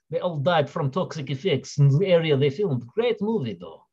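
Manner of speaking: hammed-up amateur Russian accent; shoddy Russian accent. Streamer reviewing the film to viewers, in a shoddy Russian accent. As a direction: keep up a badly faked Russian accent. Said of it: "That was a really shitty Russian accent".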